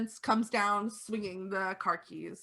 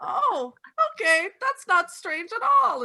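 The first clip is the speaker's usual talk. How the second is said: much higher, louder granny fussing; doting old lady; 9 semitones higher, 7 dB louder than usual. Acted. Old lady fusses over the vampire who broke her glass. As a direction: coo over the guest.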